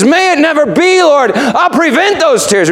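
high, pleading protest; Peter pleading. Acted. Preacher voicing Peter pleading with Jesus. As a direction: beg him to stop, voice lifted high. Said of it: "Peter takes him aside and he says".

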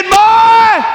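booming high shout so all can hear; really loud. Acted. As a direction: call him out before everyone. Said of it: "He's speaking really, really loud".

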